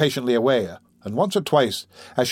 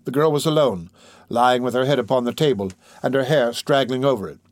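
ordinary delivery

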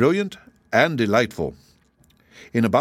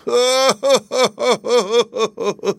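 high, loud wail breaking into sobs; 13 semitones higher, 4 dB louder than usual. A narrator's reading. Kangaroo crying bitterly by the roadside, wailing. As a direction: bawl in long, juddering high sobs. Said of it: "wailed the kangaroo".

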